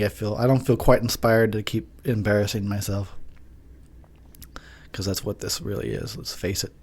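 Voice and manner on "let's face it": whispered aside. Real, not acted. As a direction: breathe it out, no voice. Unvoiced aside.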